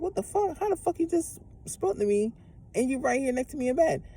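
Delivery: high-pitched disbelieving yell at the ex; shocked outburst. Retold or imitated. Woman in bed shrieking at her ex beside her, high and disbelieving. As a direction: shriek it, thrown by seeing him twice.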